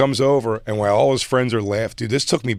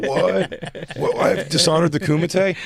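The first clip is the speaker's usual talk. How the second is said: high, baffled mock fighter; lightly voiced confused fighter; Bloodsport fighter; 7 semitones higher, slower than usual. Fighter shocked the judges turned away, mild mock. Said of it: "the guy was like".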